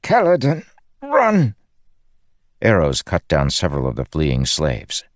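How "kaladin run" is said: very high, strained urgent cry; through clenched teeth. A narrator's reading. Wounded Nalma urging Kaladin to flee, strained through clenched teeth. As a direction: urge him away through pain. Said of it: "said through clenched teeth".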